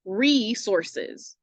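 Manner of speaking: "resources" stretched out, long "reee" first; slow demonstration. Shown as a demonstration. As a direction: say "resources" slowly, drawing out the first syllable. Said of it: "start saying words slowly".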